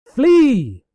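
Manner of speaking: high, loud hammed-up cry; mock panicked cry. Celestia crying out to her servant in mock alarm. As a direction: shout it like a fleeing hero.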